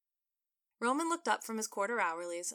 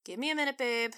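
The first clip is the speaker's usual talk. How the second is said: testy; 4 semitones higher, 6 dB louder than usual. Acted. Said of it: "she said testily".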